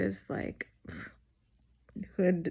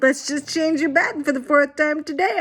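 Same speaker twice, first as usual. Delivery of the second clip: cheery nurse; 7 semitones higher, 5 dB louder than usual. Retold or imitated. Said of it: "She's like".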